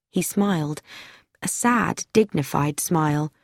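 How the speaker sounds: ordinary delivery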